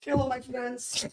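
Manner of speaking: put-on soft, slow, high Russian-accented hello; Russian-accented greeting. Guest greets in Russian character.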